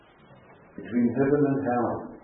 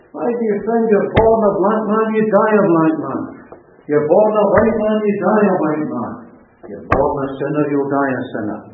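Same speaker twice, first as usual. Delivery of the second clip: loud, raised preacher's delivery; forceful preaching; 6 semitones higher, 10 dB louder than usual. Real, not acted. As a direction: drive each phrase home to the congregation. Preacher driving point home.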